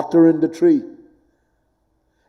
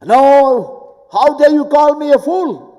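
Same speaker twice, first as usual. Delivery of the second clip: much higher, louder pretend offence; mock outrage; 10 semitones higher, 4 dB louder than usual. Someone called a fool, hammed-up furious protest. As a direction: squeal at being called a fool.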